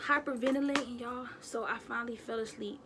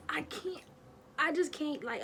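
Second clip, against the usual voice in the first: ordinary talk, nothing marked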